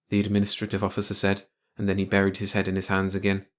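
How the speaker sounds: plain reading voice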